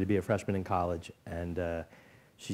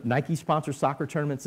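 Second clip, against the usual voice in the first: ordinary talk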